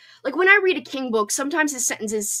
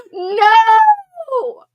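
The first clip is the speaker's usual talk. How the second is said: high, loud, wailing no; anguished cry; 12 semitones higher, 11 dB louder than usual. Real, not acted. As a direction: cry out as if heartbroken. Dramatic dismay at friend's DNF.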